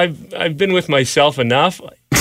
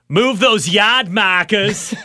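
put-on higher, drawn-out Boston accent; 5 semitones higher, slower than usual. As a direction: ham up the Boston dropped R. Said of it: "Well, I agree. I'd take".

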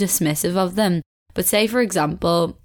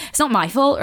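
higher-pitched cheeky retort; 6 semitones higher than usual. Acted. Waitress answering back to a customer, in a cheeky way. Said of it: "a cheeky way, being like".

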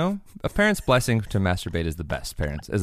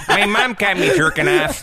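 put-on high, slow, laughing Chicago accent; 12 semitones higher, 6 dB louder than usual. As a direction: lay the Chicago accent on thick, slowly. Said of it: "making his own Chicago accent".

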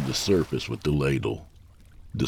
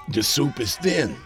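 higher-pitched, lightly voiced resigned reply; mildly weary character voice, pitched up; resigned apprentice's master; 9 semitones higher than usual. A narrator's reading. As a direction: state it with a touch of resignation, pitch raised. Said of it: "He looked at his apprentice and sighed".

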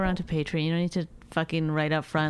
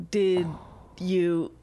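usual speaking voice

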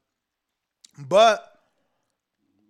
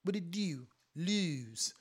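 put-on quiet, tentative Baltimore accent; half-successful Baltimore accent; 10 dB quieter, slower than usual. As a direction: test the Baltimore oo sound under your breath. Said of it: "I can't even do it".

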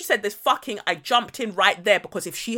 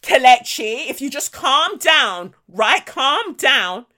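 loud, drawn-out mock placating; hammed-up condescension, each phrase lifted; patronising soothing; 2 semitones higher, 5 dB louder, slower than usual. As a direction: talk down as if settling a dog. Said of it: "as if you're a dog".

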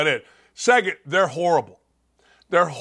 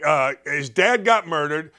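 ordinary talk

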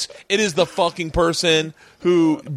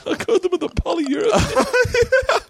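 slow, higher, laughing through it; mock him while cracking up; laughing mock quote; slower than usual. Real, not acted. Mocking the friend's idea.

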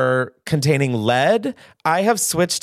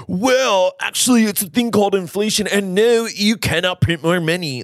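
smug mansplaining voice, higher and fast; mocking finance bro; 6 semitones higher than usual. Podcaster mocking an imagined finance bro, smug mock voice. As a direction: talk down like a know-it-all finance bro. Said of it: "some fucking finance bro is about to slide in and be like".

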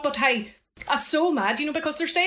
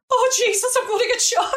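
much higher, louder comic fright; mock panic; 12 semitones higher, 4 dB louder than usual. Sister recalling her fright at the graveyard, much higher mock panic. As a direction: ham up the terror, half laughing at it. She feared being shot.

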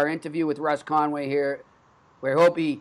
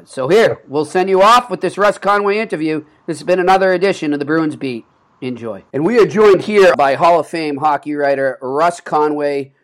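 louder radio host sign-off; announcer read; 10 dB louder than usual. Acted. Host closing the Bruins Beat show, announcer voice. Show outro and interview intro.

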